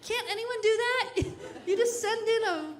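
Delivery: high, slow, amused scoff; laughing mock disbelief. Audience mocking, laughing.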